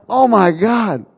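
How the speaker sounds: a stunned, slowed-down gasp of shock; shocked cry. Retold or imitated. Teller reliving the wrench hitting his face, shocked cry. Retold shock after injury.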